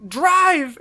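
loud, high mock command; imitated urgent order. Retold urgent order in getaway.

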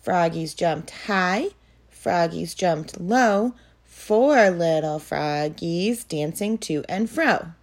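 rhythmic sing-song poem reading; poem recitation. Acted. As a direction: recite it evenly for little kids. Teacher models two phrases.

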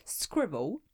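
posh English accent, rising squirrel. Shown as a demonstration. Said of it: "You didn't have to say it in a posh accent".

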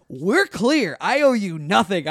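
loud, emphatic retold declaration; firm defiance. Teenager settling up with a shady man, loud defiance. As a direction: lay it down slowly and forcefully.

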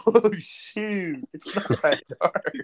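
high-pitched retold surprise; mock sudden realisation. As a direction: act out a friend suddenly catching on.

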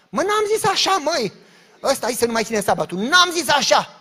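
loud, high indignant denial, slowing at the end; exasperated protest. Real, not acted. Pastor protesting the false reports, loud and indignant. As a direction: protest the lie, fed up.

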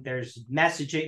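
ordinary talk, nothing marked

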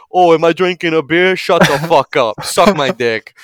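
loud cocky Guido retort; aggressive Boston bro. Retold or imitated. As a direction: snap back like a Southie bro. Guido snaps back.

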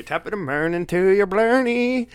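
hammed-up Irish blarney, slowly stretched; mock Irish. Host welcoming European listeners in a hammed-up Irish accent.

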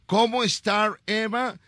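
high, slow Spanish with a gringo English accent; mock English. Shown as a demonstration. Host quizzing little Eva in English, mock English accent. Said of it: "Si yo te digo".

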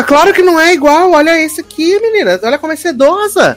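high, insistent mock mom; hammed-up retold mother. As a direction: pitch it way up like a fussy mother. Mother voiced showing fabrics.

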